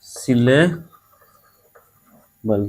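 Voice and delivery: Syllable broken in two, "सिले", long pause, "बल"; split into syllables. Shown as a demonstration. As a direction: say syllable very slowly, pausing before the last syllable बल. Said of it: "दो syllable है". (Syllable को दो टुकड़ों में, रुककर धीरे-धीरे बोलें)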